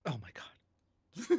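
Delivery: slow breathless whisper; slow whispered oh my God; whispered gasp. Real, not acted. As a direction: hush it out in disbelief. Hearing the covid claim.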